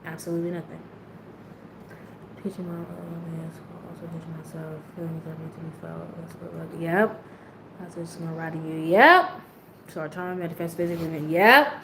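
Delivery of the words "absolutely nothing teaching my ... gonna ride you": quiet aside. Real, not acted. To her man off to the side, much softer.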